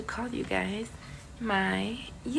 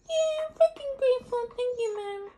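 very high squeaky grateful dog voice; voicing the dog; 12 semitones higher than usual. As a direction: answer as the dog. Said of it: "Are you grateful?".

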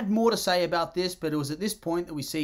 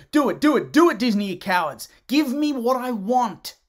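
loud pleading demand; 6 semitones higher, 6 dB louder than usual. Real, not acted. Demanding a spin-off.

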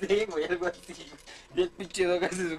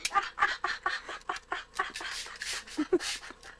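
hysterical laugh; 8 semitones higher, 5 dB quieter than usual. Real, not acted. Cracking up at friend.

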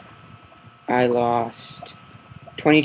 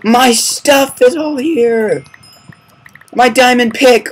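gasp, then high loud delighted shout; overjoyed to get it all back; loud thrilled relief; excited relief; 11 semitones higher, 9 dB louder, slower than usual. Real, not acted. Finds his lost items.

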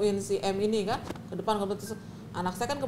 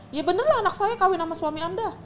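much higher, curt and blunt; curt retort; 9 semitones higher, faster than usual. Retold or imitated. Mother bluntly telling the wife it's true. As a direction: snap back at her bluntly.

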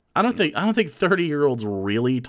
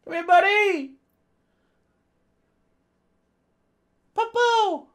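calling a pet. Real, not acted. Owner calls Darwin the dog to come, high calling voice. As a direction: call the dog over in a high voice.

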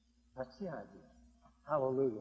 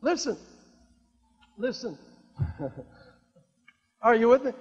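ordinary talk, nothing marked

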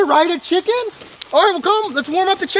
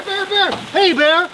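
ordinary talk, nothing marked

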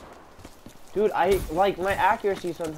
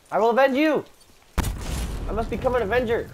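loud, high, dramatic revenge vow; mock heroic vow; 10 semitones higher, 6 dB louder than usual. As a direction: swear vengeance like a movie hero. Vows revenge after teammate dies.

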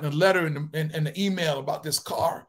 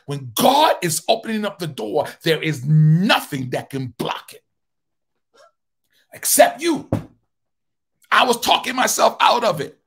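preaching climax; 8 dB louder than usual. Acted. Preacher warning the congregation, loud rising preaching. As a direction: build the line to the top.

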